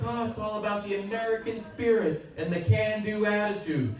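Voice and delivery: high, light, starry-eyed voice; put-on idealism in a high voice; idealistic American. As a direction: sing the nation's praises, pitched well up. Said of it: "we're very idealistic".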